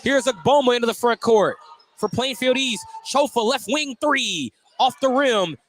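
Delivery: tense late-game call; excited play-by-play. Real, not acted. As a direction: call every touch of the last possession. Live play-by-play, final seconds.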